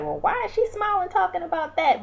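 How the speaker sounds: high, puzzled judging voice; mock disbelief at her smiling; incredulous. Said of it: "people are like".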